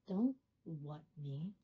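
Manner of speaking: low, slow, soft mock menace; quiet reproach. Affronted caller to night clerk, low and slow. Retold caller after long pause.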